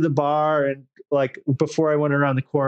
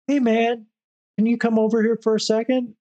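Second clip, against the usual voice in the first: higher, quick mock voice of a stranger; imitating the stranger; 7 semitones higher than usual. S0 retells the stranger calling him over, in a higher mock voice. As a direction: play the street guy calling you over, higher.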